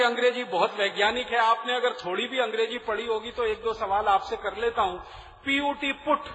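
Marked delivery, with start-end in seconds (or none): 5.4-6.2 "पुट" said with a short oo vowel; usual PUT pronunciation. Shown as a demonstration. Speaker quizzes audience on English spelling, "पुट" with short oo. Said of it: "उच्चारण तो एक ही होना चाहिए".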